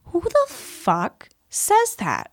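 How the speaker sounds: high, slow, drawn-out disbelief; retold incredulity. Reacting to a veteran's callous post, incredulous.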